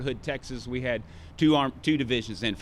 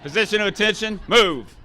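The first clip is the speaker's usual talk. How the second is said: loud, higher command, Move hit hard; drill command; 8 semitones higher, 9 dB louder than usual. Shown as a demonstration. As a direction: order the formation to attention. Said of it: "I need my demonstrator to post here".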